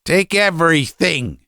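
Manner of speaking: high, strained cry of a father; father cracking under demands; unnatural shout. A narrator's reading. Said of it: "he shouted in an unnatural voice".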